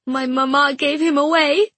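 high, loud, distressed confession; near crying. Acted. Girl confessing her mother's act to a friend, near crying. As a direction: raise the voice toward tears. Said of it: "began to cry".

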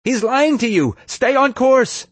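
loud, very high urgent yell; shrieked command. A narrator's reading. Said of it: "Ashley who yelled at Nefarious".

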